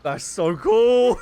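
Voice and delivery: slow, loud words dissolving into a high laugh; thrilled laughing. Real, not acted. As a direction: gush over the cool move. Thrilled by the ultimate attack.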